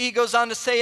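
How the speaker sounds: normal talking voice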